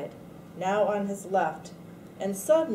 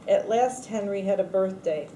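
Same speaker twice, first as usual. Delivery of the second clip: plain reading voice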